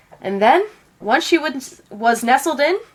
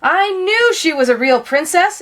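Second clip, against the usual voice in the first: higher, a bit louder, delighted exclamation; prince exclaiming; 7 semitones higher, 4 dB louder than usual. Acted. The prince rejoicing that she is a real princess, excited. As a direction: burst out gladly as the young prince. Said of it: "exclaimed the prince".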